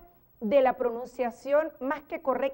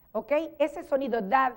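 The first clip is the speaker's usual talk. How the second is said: ordinary delivery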